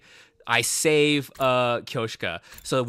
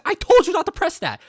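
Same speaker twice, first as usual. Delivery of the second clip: high, loud exasperated yell; angry yell; 8 dB louder than usual. Retold or imitated. Tsubasa yelling at Gaku over the alarm, loud and exasperated. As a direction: snap at him for pressing the button. Alarm goes off after button.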